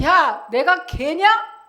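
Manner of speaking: high, drawn-out mocking question; Goliath's jeer. Goliath sneering at David and his sling, mock jeer. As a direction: jeer it slowly, acting out an affronted giant. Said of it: "라고 조롱했던 것처럼".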